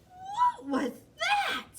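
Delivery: very high, slow, loud question; startled kitten, pitch shot up; astonished exclamation. A narrator's reading. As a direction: draw it out in squeaky amazement. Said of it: "exclaimed Skippy John Jones".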